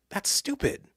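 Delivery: high-pitched, exasperated retort; frustrated. Retold or imitated. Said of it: "I got so frustrated".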